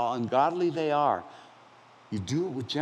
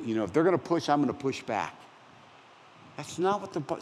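ordinary talk

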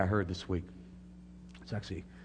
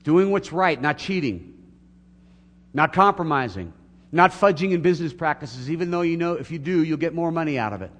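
loud, raised voice, driving through the list; forceful preaching; 6 semitones higher, 9 dB louder than usual. Real, not acted. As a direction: preach it with force, each phrase hammered.

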